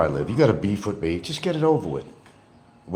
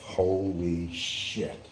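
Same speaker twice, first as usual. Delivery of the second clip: slow stunned; 11 dB quieter, much slower than usual. Retold or imitated. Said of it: "I thought to myself".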